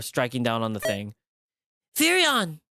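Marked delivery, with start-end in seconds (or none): 2.0-2.6 calling out. Acted. Fox cries out for the cat.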